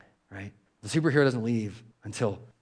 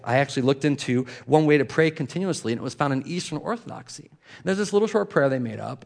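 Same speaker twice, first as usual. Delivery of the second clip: normal talking voice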